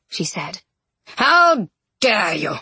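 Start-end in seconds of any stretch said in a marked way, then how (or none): 1.2-2.5 slow, drawn-out outrage, then a raised call; louder, higher shout on the last word; outraged cry. A narrator's reading. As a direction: call the guards like an affronted king. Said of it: "he cried".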